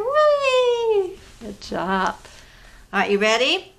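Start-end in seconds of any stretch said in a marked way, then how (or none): 0.0-1.2 very high gleeful squeal; high playful whee. Pretend. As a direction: squeal it like a kid on a slide. Playing flying with kids.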